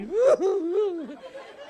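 loud high blubbering wail, half laughed; mock sob of a crying drunk; imitated crying. Drunk Percy at the dump, in a loud mock wail. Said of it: "He'd be crying".

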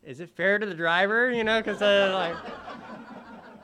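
high, loud words through laughter; real laughter breaking through; laughing loudly. Real, not acted. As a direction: talk while cracking up. Laughing while telling the Uber story.

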